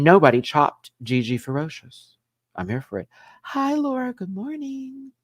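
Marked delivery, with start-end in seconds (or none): 3.4-5.1 much higher, sing-song greeting; a strangely high, stretched good morning; odd high greeting. Real, not acted. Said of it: "Why did I say it like that?".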